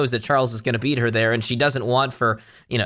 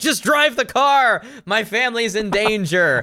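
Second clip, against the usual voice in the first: pleading urgently in a raised pitch; mock desperate urgency; 7 semitones higher than usual. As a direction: play a hammed-up panic at the driver. Acts desperate woman ordering driver.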